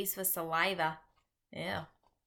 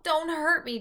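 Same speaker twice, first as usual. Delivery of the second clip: higher, louder begging; pleading yell; 9 semitones higher, 7 dB louder than usual. A narrator's reading. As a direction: beg desperately. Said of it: "he yelled".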